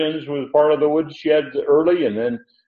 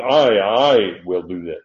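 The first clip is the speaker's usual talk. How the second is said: "I, I" doubled and leaned on hard; emphatic I; 3 semitones lower than usual. Shown as a demonstration. Preacher quoting Paul's extra pronoun, heavy stress on "I, I". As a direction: hammer both words "I" as the emphatic pronoun. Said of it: "put an emphatic in there".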